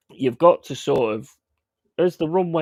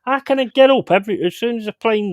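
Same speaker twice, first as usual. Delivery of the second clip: exasperated outburst; 9 semitones higher, 6 dB louder, faster than usual. Real, not acted. Exasperated at his dad.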